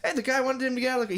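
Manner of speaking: high, quick mock protest; acted argument. Acting out the guys arguing by the car, high excited mock voice. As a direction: rattle it off in a high quoted voice.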